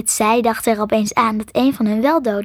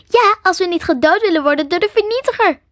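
higher, sharp angry reply; 8 semitones higher than usual. Acted. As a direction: bite back in irritation. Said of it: "antwoordde Ellen boos". (boos, scherp en hoger)

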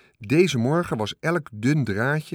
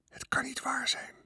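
unvoiced, awed whisper; hushed, voiceless whisper; 8 dB quieter than usual. A narrator's reading. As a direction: whisper it in disbelief. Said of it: "fluisterde hij".

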